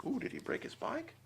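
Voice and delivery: high, soft gasping worry; wide-eyed worried question; mock gasp. A narrator's reading. Narrator asks the kids anxiously.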